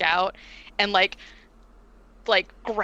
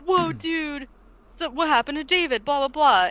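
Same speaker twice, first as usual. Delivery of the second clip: surfer dude voice; 7 semitones higher than usual. Retold or imitated.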